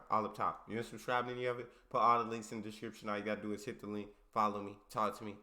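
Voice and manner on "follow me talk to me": ordinary delivery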